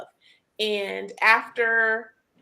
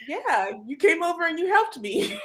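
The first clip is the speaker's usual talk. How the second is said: laughing; 7 semitones higher than usual. Real, not acted. Laughing thanks.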